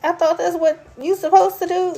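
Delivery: naive innocent reply. Retold or imitated. Answering friends, lightly mock innocent. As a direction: play it wide-eyed. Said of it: "And I'm like".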